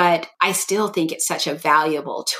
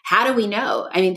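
normal talking voice

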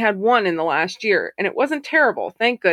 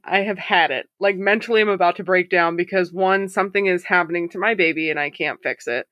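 ordinary talk, nothing marked

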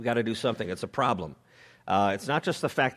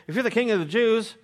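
mocking soldiers; 10 semitones higher than usual. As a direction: taunt a condemned man. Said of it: "The soldiers also mocked him".

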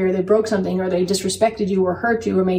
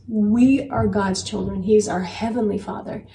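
ordinary talk, nothing marked

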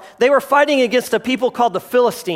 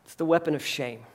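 much softer and lower, a grave hushed reveal; 7 semitones lower, 10 dB quieter than usual. Real, not acted. Preacher revealing Satan's weapon to the congregation, hushed. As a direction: let the word sink in quietly.

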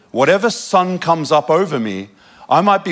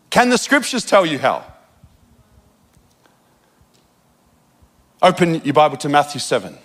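raised pulpit intensity; fervent preaching; 4 semitones higher than usual. Acted. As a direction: drive the questions at the congregation. Preaching at full force.

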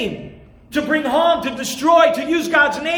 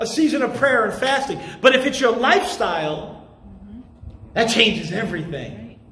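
usual speaking voice

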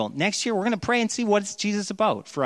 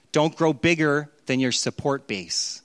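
normal talking voice

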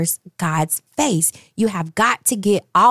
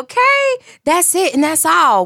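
much higher, bubbly and excited; 11 semitones higher, 4 dB louder than usual. Real, not acted. Host wrapping up the song, giddy and excited. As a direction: gush with delight.

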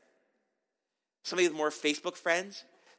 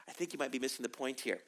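softer, quick, deadpan reply; dry understatement; 5 semitones lower, 9 dB quieter, faster than usual. Acted. God calmly answering the whiny Gideon, soft and dry. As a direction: undercut the complaint softly and fast.

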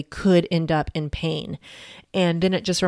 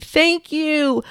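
high, called-out thank you across a street; mock shout of gratitude to a crying mom; imagined yell; 10 semitones higher than usual. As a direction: call the thanks out to a stranger far away. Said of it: "I really wanted to yell out to her across the street".

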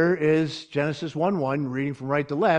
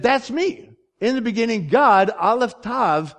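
emphatic voice of Jesus; 7 semitones higher, 4 dB louder than usual. Acted. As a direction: ring out God, Aleph Tav like a revelation. Jesus voiced claiming Genesis 1:1.

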